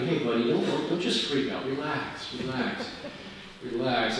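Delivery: calm your younger self down gently; gentle reassurance. Retold or imitated. Advising his younger self to calm down, soft and reassuring. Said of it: "I would tell that to the young [name]".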